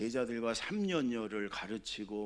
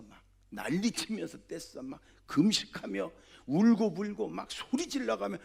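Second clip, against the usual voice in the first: fervent urging; 7 semitones higher, 4 dB louder than usual. Acted. Jesus urging fervent prayer, rising.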